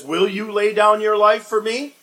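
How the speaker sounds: loud, high, pointed challenge; challenging question. Acted. Jesus challenging Peter's boast, loud and pointed. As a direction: throw the question back at him hard.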